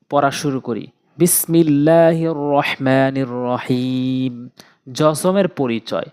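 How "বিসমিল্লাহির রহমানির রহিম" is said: slow recitation. Real, not acted. Opening recitation before reading.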